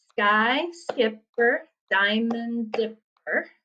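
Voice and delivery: "Sky skipper, diamond dipper" very slowly, split into syllables. Shown as a demonstration. Teacher counts the second line, "Sky skipper, diamond dipper" syllable by syllable. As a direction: space out all seven beats of "Sky skipper, diamond dipper". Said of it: "if you count the syllables".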